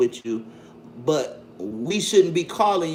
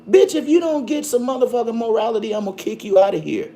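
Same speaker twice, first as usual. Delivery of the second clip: angry scolding; 5 semitones higher, 5 dB louder, faster than usual. Real, not acted. Host scolding a caller, threatening.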